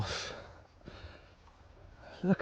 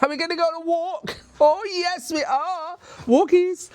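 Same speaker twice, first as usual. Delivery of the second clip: very high, fast, sing-song coo; asking the dogs if they want a walk, very high and excited; excited dog-talk voice; 4 dB louder than usual. Real, not acted. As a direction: whip the dogs into excitement with a squeaky voice.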